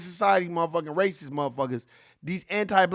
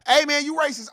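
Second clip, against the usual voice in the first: high, louder accusing call; mock accuser; 10 semitones higher, 4 dB louder than usual. Imagined critic confronting the announcer, mock accusing.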